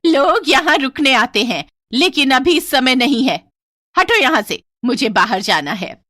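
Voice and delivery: put-on loud, stern old servant woman warning the family; stern old cook. Old cook brushes Ravi off. (बूढ़ी काकी की सख्त, ऊँची चेतावनी)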